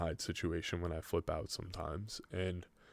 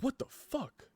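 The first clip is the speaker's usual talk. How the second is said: shocked; 3 dB quieter than usual. Retold or imitated. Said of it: "I'm like".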